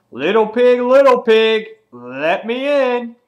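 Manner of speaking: loud, high taunting holler; high-pitched, full-voiced storyteller's yell; retold scream. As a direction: scream it out like a villain at the gate. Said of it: "And he screams".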